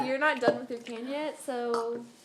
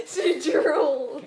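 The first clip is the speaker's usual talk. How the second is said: laughing out the words; cracking up while talking; 6 semitones higher, 8 dB louder, much slower than usual. Real, not acted. Laughing at friend drooling.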